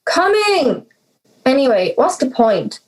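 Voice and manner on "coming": high called-out reply; calling back. Acted. Daughter answering her mother's call from upstairs, high call. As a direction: shout it back across the house, pitched high.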